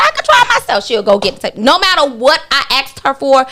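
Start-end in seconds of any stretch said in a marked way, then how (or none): 0.0-0.9 quoting her friend, pitched well up; high voiced friend. Friend agreeing to fetch the tablecloths, high pitched.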